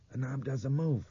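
high, lifted voice; puzzled character voice. A narrator's reading. Boy trying the locked door, high character voice.

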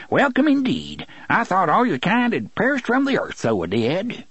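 put-on high, excited old-timer voice; cried welcome. A narrator's reading. Si marvels that a gunslinger still lives, crying out. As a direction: exclaim it high in a rustic accent. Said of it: "he cried".

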